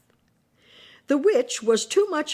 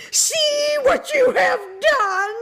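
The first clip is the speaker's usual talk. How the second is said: high, loud, slow shriek of a melting witch; melting witch screaming at Dorothy in panic; 5 dB louder than usual. A narrator's reading. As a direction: scream it in terror. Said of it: "she screamed".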